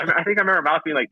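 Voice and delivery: ordinary delivery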